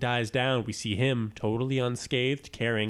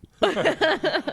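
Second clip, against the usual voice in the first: loud high laugh; 6 dB louder than usual. Real, not acted. Laughing at joke.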